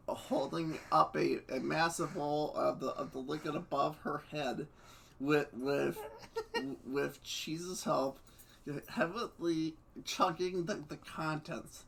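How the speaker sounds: plain reading voice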